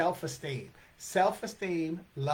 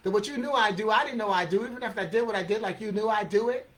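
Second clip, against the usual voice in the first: loud, high, rapid-fire verse; rapid recital; 4 semitones higher, 5 dB louder, faster than usual. Acted. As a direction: race through the tangled line without a breath. Fast take, later replayed slowly.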